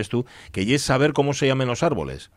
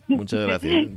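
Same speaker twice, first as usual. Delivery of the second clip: mock gratitude; slower than usual. Mock thanks for flattery.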